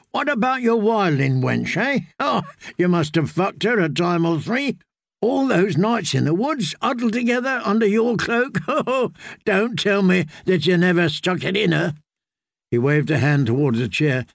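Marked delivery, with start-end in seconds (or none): 0.1-11.9 high, lewd ribbing with a chuckle; teasing drunken singer. Acted. Singer ribs friend about wildling wench, high tipsy teasing. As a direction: needle your friend like a tipsy rake.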